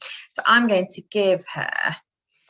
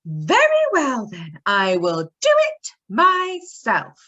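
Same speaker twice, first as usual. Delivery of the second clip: determined hen; 4 semitones higher, 5 dB louder, slower than usual. Acted. Hen answers in story.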